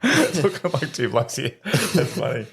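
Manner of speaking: giggling while he comments; laughing voice, words stretched out. Real, not acted. Laughs at the joke.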